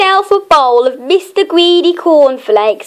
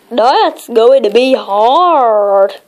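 slow, gloomy doubting voice; doubtful friend; 2 semitones lower, much slower than usual. Acted. Friend doubting the plan, slowly and gloomily. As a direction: drag it out, unconvinced.

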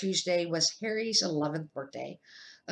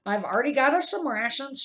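louder, higher pleased uncle; hearty Uncle Vernon; 6 semitones higher, 5 dB louder, faster than usual. Acted. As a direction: crow about the supplies.

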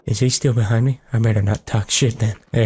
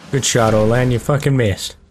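ordinary talk, nothing marked

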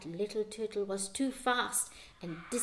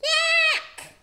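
high loud squawking yak; shrill bird cry; angry seagull yak; 10 dB louder than usual. A narrator's reading. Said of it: "with an angry yak".

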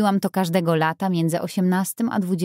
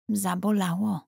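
ordinary delivery

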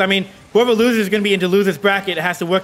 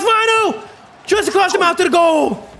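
high-pitched sportscaster cry; urgent, raised play-by-play; excited near-goal call; 9 semitones higher than usual. Real, not acted. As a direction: cry out as the chance slides past.

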